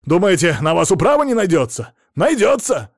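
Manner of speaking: loud, high indignant tirade; blustering, outraged shouting; angry complaint. A narrator's reading. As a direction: threaten a complaint at the top of your voice. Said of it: "накинулся на него краснолицый".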